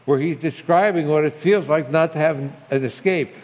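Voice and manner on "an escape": ordinary delivery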